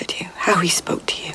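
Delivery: hushed, wary whisper; a secretive whisper. Acted. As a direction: whisper it so the Emperor won't hear. Secret talk with the Empress.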